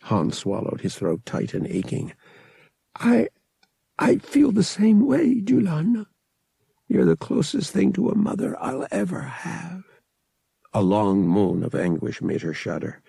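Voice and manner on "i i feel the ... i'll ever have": grieving. A narrator's reading. Han grieving over dying Julana.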